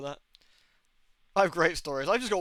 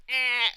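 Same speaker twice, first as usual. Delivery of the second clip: high, loud animal screech; short mock possum cry; imitated possum noise; 8 semitones higher, 5 dB louder than usual. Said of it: "and it was going".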